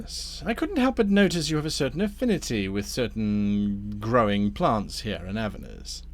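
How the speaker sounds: insinuating villain with swooping, emphatic intonation; smooth scheming Damnifer. Acted. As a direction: press a private deal on the adventurer. Game master voices Damnifer.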